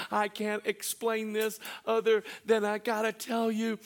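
preaching build. Acted. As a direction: build it up with fervour.

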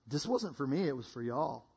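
put-on low, soft Southern-accented correction. Jesus correcting the people, gentle Southern accent. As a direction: quietly set them straight with a Southern touch. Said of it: "Jesus is from the South".

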